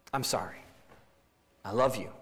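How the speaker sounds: soft, low, sincere sorry; tender apology. Retold or imitated. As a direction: gently make peace with your spouse. Spouse apologizing to break cycle.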